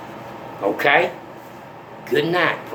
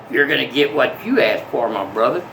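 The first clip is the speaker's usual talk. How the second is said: usual speaking voice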